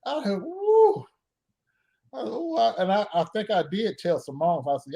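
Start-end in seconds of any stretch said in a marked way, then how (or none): 0.4-1.0 a high woozy whoop as the room spins; dizzy woo. Retold or imitated. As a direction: let out a giddy high woo, lightheaded. Room spinning from pain pills.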